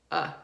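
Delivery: short, isolated "Uh"; schwa sound. Shown as a demonstration. Teacher demonstrating the schwa sound, short "Uh". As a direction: say "Uh." as a relaxed schwa vowel. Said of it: "It sounds like this".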